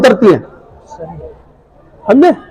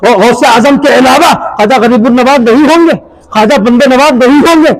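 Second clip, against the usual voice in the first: normal talking voice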